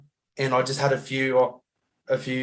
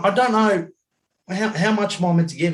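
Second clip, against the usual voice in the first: higher-pitched mock helplessness; retold fluster; 6 semitones higher than usual. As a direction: act out being flustered at the cash machine. Retold flustered question at ATM.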